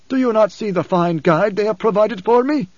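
high, emphatic preacher's voice; the preacher, challenging. Acted. Preacher asks Leto about his guide, high and challenging.

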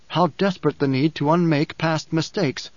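ordinary delivery, nothing marked

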